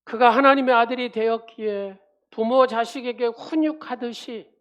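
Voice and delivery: loud, fervent sermon delivery; preaching. Acted. Pastor preaching to the congregation, loud and fervent. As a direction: preach it out to the whole church.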